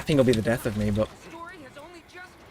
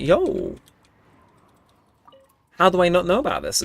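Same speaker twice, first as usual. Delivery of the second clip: excited; 6 semitones higher, 4 dB louder than usual. Real, not acted. Excited discovery of website.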